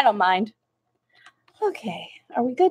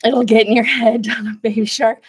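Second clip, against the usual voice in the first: ordinary talk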